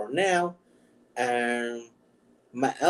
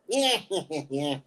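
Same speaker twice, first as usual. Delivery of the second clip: Penguin; 3 dB quieter than usual. Acted. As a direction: squawk it like a comic-book bird villain. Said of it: "could be a Penguin".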